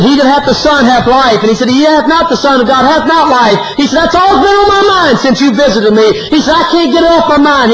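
high, urgent retold outburst; preacherly cry, pitch riding high; impassioned confession. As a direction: re-enact a convicted man pouring it out. Convicted friend recites verse back.

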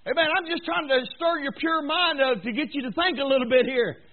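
high and loud, rising to a shout; full-force preaching. Acted. Preacher challenging the congregation, climbing to a shout.

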